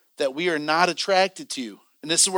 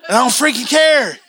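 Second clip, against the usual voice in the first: raised-pitch defiant backtalk; re-enacted huffy, higher-pitched retort; mouthy attitude; 6 semitones higher, 4 dB louder than usual. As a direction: act out mouthing off to your wife. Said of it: "I'm getting mouthy to her".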